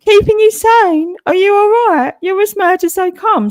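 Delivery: high, loud, sneering put-on voice; mocking falsetto. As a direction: parrot him in a squeaky mocking falsetto. Mocking the rival's words.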